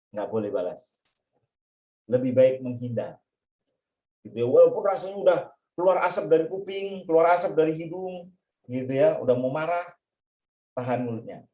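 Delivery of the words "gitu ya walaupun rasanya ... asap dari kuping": ordinary delivery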